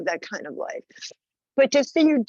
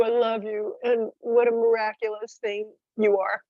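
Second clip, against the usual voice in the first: usual speaking voice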